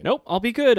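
high loud nervous promise; nervous blurt. Retold or imitated. Patient promising the nurse, high and loud. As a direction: rush it out to reassure.

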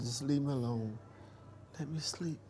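barely audible, worn-out plea to be left alone; imitating a faint, exhausted murmur; weak and fading. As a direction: let the words die away like a sick man drifting off. Sick man refusing his mother.

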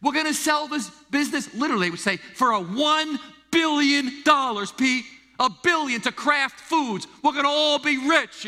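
high, loud boasting uncle; boastful uncles. Retold or imitated. Italian uncles bragging to young Pete, high and loud. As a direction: crow about the billion-dollar sale. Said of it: "my uncles would say it".

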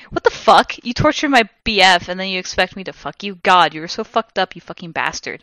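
plain reading voice

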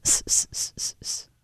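breathy sniffing snicker through the nose; huffing hiss laugh. Retold or imitated. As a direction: huff out a silent snicker. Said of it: "and they'll go".

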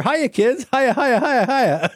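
high, loud, goofy cartoon greeting; sing-song hammed-up hiya over and over; Watcher hamming it up. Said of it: "Ooh, the Watcher".

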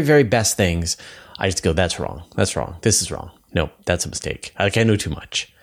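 ordinary delivery

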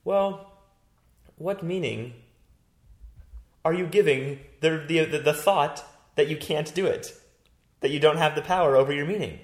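imitating her question. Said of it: "And then she's like".

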